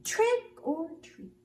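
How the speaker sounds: very high, slow sing-song call; high drawn-out spooky call; high squeaky trick or treat. Acted. Bat says trick or treat.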